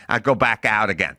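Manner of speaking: usual speaking voice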